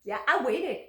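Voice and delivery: ordinary talk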